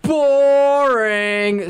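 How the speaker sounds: drawn-out bored sigh. Retold or imitated. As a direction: drag the word out, bored. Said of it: "She goes".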